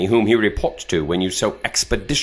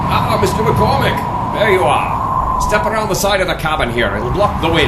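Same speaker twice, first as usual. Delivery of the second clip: high, loud hearty hail; calling over wind; 11 semitones higher, 5 dB louder than usual. Acted. Captor greeting McCormick over the wind, loud and high. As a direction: hail him across the windy deck.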